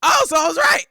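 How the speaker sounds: real excited high-pitched yell; triumphant shouting. Real, not acted. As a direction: shout it out, thrilled to be right. Celebrates guessing the answer right.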